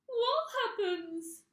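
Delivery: very high, loud gasp; frightened gasp. A narrator's reading. Mrs Twit gasping in terror at her husband.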